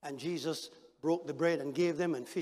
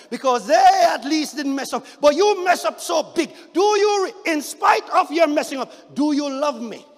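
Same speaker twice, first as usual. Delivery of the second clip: high, loud, insistent appeal; loud pressing plea; 7 semitones higher, 12 dB louder than usual. Acted. Jesus confronting Peter over his failure, loud plea. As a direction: push Peter to answer.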